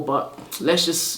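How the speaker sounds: ordinary talk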